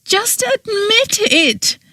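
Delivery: a high, slow, drawn-out wail. A narrator's reading. Petra begging Oberon to admit it, wailing. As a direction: cry out at him in despair. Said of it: "she wailed".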